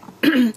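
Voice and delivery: short throat clear. Real, not acted. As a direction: clear the throat before speaking. Frog in throat before speaking.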